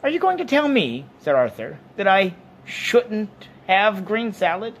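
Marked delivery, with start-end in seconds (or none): 0.0-1.1 very high, loud challenge; high-pitched, affronted questioning; indignant Arthur. Acted. 2.0-4.7 high, slow, loud challenge; drawn-out, high-pitched indignant question; indignant Arthur. Acted.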